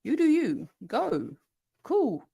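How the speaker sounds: high, airy "you do you"; breezy cheering on. Shown as a demonstration. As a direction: cheer them on casually, high. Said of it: "pop off is like".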